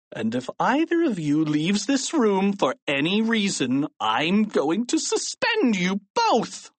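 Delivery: high, relishing villainous threat; evil grin. A narrator's reading. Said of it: "said with an evil grin".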